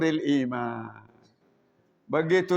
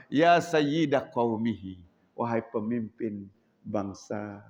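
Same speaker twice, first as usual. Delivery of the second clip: gentle respectful address; 9 semitones lower, slower than usual. Acted. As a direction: speak with a smile. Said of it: "lemah lembut".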